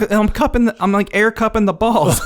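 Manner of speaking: high, excited mock explanation with a laugh; imitated animated protest. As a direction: explain it with comic urgency. Acts animated character demonstrating his gesture.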